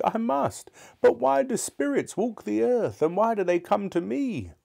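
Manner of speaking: put-on high-pitched anxious old miser; frightened Scrooge. Scrooge asking why spirits visit him, high and fearful. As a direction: plead for answers in a very high voice. Scrooge questions the ghost in fear.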